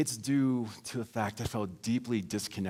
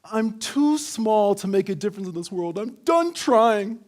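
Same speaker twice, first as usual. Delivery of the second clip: mock teenage outcry; 10 semitones higher, 7 dB louder than usual. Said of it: "I said".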